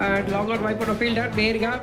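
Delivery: normal talking voice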